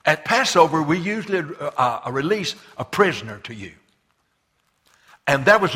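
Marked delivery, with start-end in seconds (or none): 0.0-3.7 a touch of Pilate bargaining, louder and quicker; deal-making ruler pitching an offer to the crowd; lightly voiced governor, loud and fast. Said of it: "He said".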